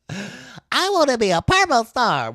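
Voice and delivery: high, loud petulant star demanding purple; whiny star voice. Pretend. Designer voicing a stubborn graphic, high whiny demand. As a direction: pout it out like a spoiled star.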